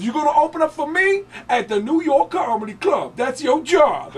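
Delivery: loud, high impersonation of a comedian; hammed-up, high-pitched proclamation; Tracy Morgan. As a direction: declare it in a big mimicked shout. Tracy announcing the gig, acted.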